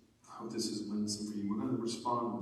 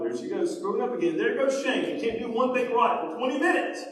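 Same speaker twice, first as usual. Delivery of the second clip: imitated exasperated fault-finding, building louder; mock scolding; 9 semitones higher, 8 dB louder than usual. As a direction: grumble about someone's failings, rising in volume and pitch. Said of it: "the way I usually hear it is like".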